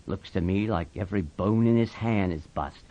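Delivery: low, soft, sober voice; hushed, deep-pitched assessment; grave Slim. Acted. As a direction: give the verdict quietly. Slim examines the hand.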